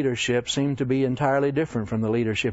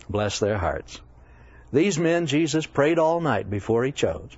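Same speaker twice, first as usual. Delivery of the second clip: usual speaking voice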